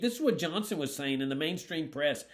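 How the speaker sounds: ordinary delivery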